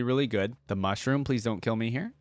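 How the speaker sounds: usual speaking voice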